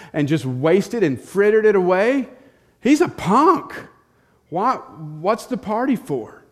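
loud, high, indignant rant; resentful brother. Acted. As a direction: spit out the jealousy. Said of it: "key case of sibling rivalry".

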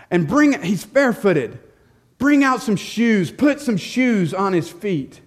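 loud, high, overjoyed commands; a father bursting with joy; joyful orders. Acted.

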